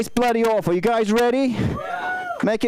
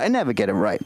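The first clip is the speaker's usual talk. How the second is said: much lower; 12 semitones lower, faster than usual. Shown as a demonstration.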